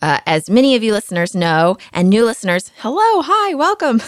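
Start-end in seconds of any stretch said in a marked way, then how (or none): 2.8-4.0 high-pitched hello to newcomers; bright greeting. Real, not acted. Host greeting new listeners, high cheery welcome. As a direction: sing out a cheery welcome, much higher.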